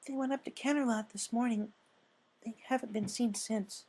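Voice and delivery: put-on gentle shy girl voice; Fluttershy voice.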